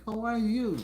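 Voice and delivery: ordinary talk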